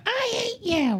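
high, far-off hammed-up "I hate you" from a sulky kid; mock dramatic hatred. Mom imitating a dramatic child tantrum, pitched high and hammed-up. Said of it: "it wasn't like a".

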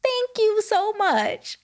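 high, very slow, sugary thank-you; sweet fake thanks. Thanking the officer who let her off, high and sugary. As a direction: thank the cop in an over-sweet drawn-out way. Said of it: "And I was like".

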